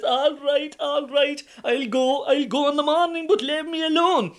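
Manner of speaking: high, loud exasperated giving in; nagged husband finally caving, high and loud; worn-down surrender. Acted. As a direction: give in just to be left alone.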